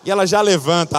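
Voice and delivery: ordinary delivery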